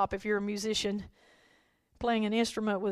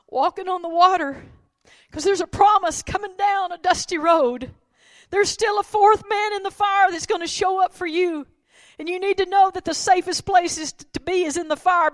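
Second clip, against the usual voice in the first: loud, high preacher's call; ringing hope sermon cry; full-force preaching; 8 semitones higher, 8 dB louder than usual. Acted. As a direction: lift the promise to the congregation.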